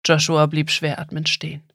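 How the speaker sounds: plain reading voice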